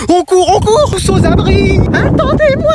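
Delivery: very high-pitched alarm; panicked fleeing cries. Acted. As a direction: cry out while running for cover. Children flee when parents find them.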